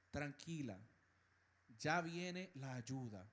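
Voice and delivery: soft, hushed reassurance; God's gentle voice. Acted. God to the frightened believer, soft and reassuring. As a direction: comfort someone frightened, gently and softly. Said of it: "Así como Dios te dice".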